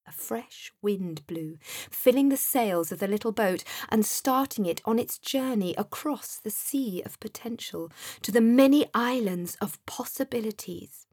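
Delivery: ordinary delivery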